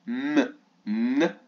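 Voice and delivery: "M" and "N" as held hums, not letter names; isolated nasal sounds. Shown as a demonstration. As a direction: hum "M", pause, then hum "N". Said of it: "Ça ne fait pas le même son". (sons « M » puis « N » tenus et séparés)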